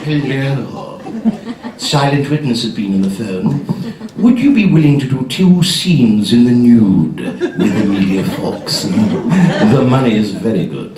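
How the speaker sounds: low croaky agent; low, laughing croaky agent; agent's voice. Retold or imitated. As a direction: quack it out like a duck with a throat blockage. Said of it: "They talk like that, a cross between a duck".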